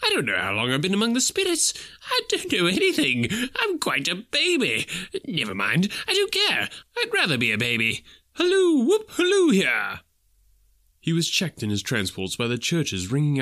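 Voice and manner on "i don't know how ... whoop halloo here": put-on fast, high, childlike glee ending in whoops; giddy Scrooge. A narrator's reading. Scrooge, not knowing the date, whooping with giddy joy. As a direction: babble happily like a baby.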